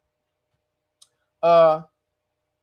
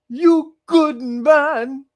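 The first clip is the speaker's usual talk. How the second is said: slow, drawn-out and louder broken English; louder mock dying plea; 4 semitones higher, 6 dB louder, much slower than usual. The dying girl to Marco Polo, slow and louder. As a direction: push "you good man" out loud as a final plea. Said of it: "She said".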